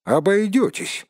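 high, sharp refusal; defiant refusal. A narrator's reading. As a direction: refuse outright, pitch jumping up. Bilbo refuses Gollum. (дерзкий отказ, повышенным тоном)

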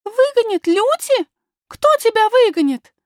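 put-on very high, loud child's questions; high-pitched, indignant surprise; astonished little princess. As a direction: ask in disbelief as a small girl. Little princess astonished at nurse's fear.

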